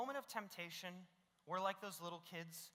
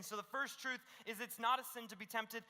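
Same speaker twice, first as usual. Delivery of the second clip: ordinary delivery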